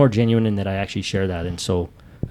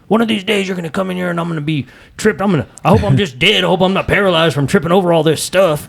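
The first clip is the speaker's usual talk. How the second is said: loud, high, fast mock rant about tripping; hammed-up grumbling at the messy garage; mock annoyed rant; 10 semitones higher, 5 dB louder than usual. Husband retells snapping at his wife: high, loud mock rant. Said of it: "And I'm like".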